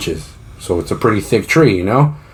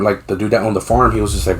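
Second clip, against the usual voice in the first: normal talking voice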